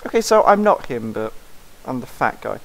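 ordinary delivery

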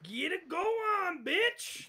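high-pitched hype; excited cheer. Real, not acted. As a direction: cheer it on in a squeal. Cheering on the clip.

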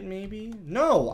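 usual speaking voice

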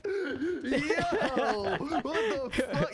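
real incredulous laughter; laughing disbelief. Real, not acted. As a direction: crack up while you say it. Cracks up at friend's odd word.

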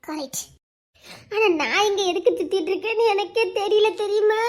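normal talking voice

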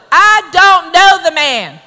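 slow, loud, emphatic oath; sworn denial. Acted. Preacher acts out Peter giving an oath, emphatic. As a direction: swear it firmly, word by word. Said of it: "He gave an oath".

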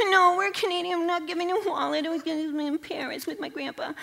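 high, rushed nervous protest; panicked refusal. Retold or imitated. Young tourist refusing a roadside robber, panicked high protest. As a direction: blurt it out, flustered and scared.

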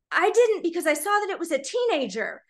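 high retold indignation; so upset. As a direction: imitate an upset man explaining why he held back. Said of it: "'cause he was so upset".

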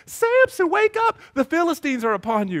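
high, loud mock alarm; alarmed Delilah. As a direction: wake him in a fake panic. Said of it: "she's like".

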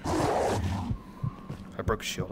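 breathy whisper, very slow. Real, not acted. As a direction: whisper it to yourself, drawn out. Whispered aside during fight.

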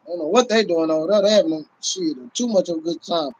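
ordinary talk

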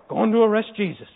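lumbering Roman brute voice; put-on ogre voice for a guard; big ogre. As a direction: play a big dumb ogre on the march. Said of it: "these big ogres".

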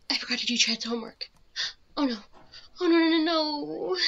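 panicked wail. Acted. Girl realising she forgot Chad's homework, panicked crying wail. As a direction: gasp and spiral into a panicked whine.

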